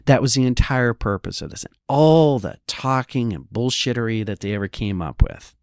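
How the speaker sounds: usual speaking voice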